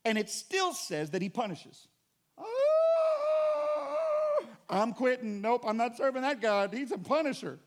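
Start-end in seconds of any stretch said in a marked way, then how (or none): 2.4-4.5 hammed-up anguished high cry; a wailing cry like a believer who has had enough; mock wail. Congregant wailing at a punishing God, hammed-up cry. 4.7-7.6 mock quitting.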